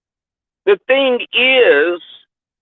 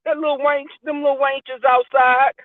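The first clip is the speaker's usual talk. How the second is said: lightly imitated elder, higher pitch; older people's talk; 6 semitones higher than usual. Older people talking about little cousins, playful imitation. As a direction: fuss like an older relative, pitched up. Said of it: "word that older people would say".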